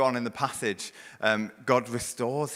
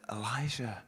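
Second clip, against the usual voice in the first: gentle God; 7 dB quieter than usual. Acted. God gently asking Elijah, soft and tender. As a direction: ask tenderly, like a father bending down. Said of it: "Gently, he deals with him".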